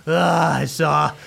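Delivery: weak, breathy gasps between words; labored breathing. Acted. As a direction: speak like you just came back from death. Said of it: "takes labored breaths".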